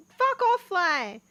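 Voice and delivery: annoyed shout. Real, not acted. Swatting at a fly.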